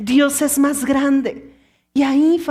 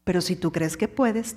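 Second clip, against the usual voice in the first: much lower and softer, settled and falling; 8 semitones lower, 7 dB quieter than usual. Shown as a demonstration. Preacher answering doubt, low and softly assured. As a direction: drop down into quiet conviction.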